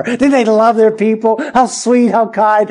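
preacher sneering at leaders' fake kindness, sarcastic. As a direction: praise them with biting irony. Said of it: "Now you see the contempt".

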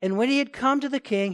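ordinary delivery

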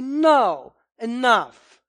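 loud and slow, bold and unbending; preacher models a loud rebuke to critics; firm loud rebuke. Shown as a demonstration. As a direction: declare it like a prophet facing sin. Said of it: "We say".

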